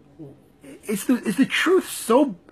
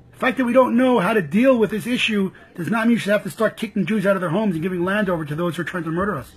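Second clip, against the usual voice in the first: ordinary talk, nothing marked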